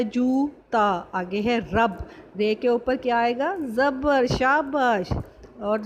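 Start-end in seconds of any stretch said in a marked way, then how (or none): 0.0-1.0 جوتا with a long, drawn-out oo; long oo sound. Shown as a demonstration. Teacher showing pupils the waw sound in جوتا, long oo. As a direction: stretch جوتا very slowly, holding the oo. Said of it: "یہ واو کی آواز دے رہا ہے".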